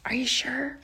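soft unvoiced whisper; quiet voice. A narrator's reading. Oscar asking his father quietly, whispered. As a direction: ask it under your breath. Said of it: "he said in a quiet voice".

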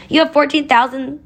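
high, strained call over rushing water; narrator's restrained shout, pitched well up; mock shout. A narrator's reading. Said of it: "Oscar shouted over the crashing of the water".

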